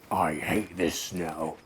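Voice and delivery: slow, grumbling moan; slow cold complaint. Acted. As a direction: drag it out like a freezing, grumpy bear. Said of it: "he moaned as he froze".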